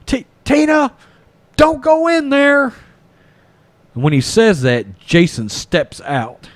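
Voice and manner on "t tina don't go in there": a much higher mock yell; the doctor calls Tina back in a high yell; imitating a yell. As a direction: call after her in a high mock yell. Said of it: "Terry Kaiser yells".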